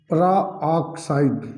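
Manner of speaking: peroxide very slow, in separated syllables; drawn-out term. Shown as a demonstration. Teacher dictating the term to students, slowly drawn out. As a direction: break peroxide into long parts.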